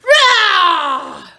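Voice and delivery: huge loud roar; bear roar. Shown as a demonstration. Reader roaring like Bruce for the children, loud roar. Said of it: "Can you roar like a bear?".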